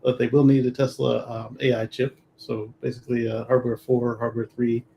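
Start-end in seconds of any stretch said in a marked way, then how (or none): none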